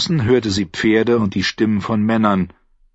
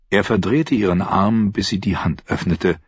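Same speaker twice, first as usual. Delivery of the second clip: usual speaking voice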